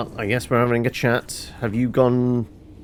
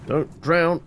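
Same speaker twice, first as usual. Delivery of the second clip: urgent call; 9 semitones higher, 5 dB louder than usual. Real, not acted. Warning characters while swimming.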